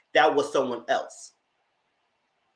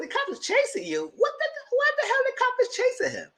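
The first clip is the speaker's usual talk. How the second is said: high, squeaky, rising to a shriek; shocked disbelief. Retold or imitated. Retelling her reaction to excuse.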